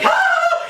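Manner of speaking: very high-pitched mock scream; imitated wild yell. Storyteller imitating Patrick at the wheel of the speeding mule, mock yell. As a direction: let out a high, hammed-up "aah".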